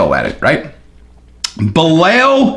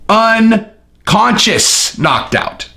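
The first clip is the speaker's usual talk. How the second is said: ordinary talk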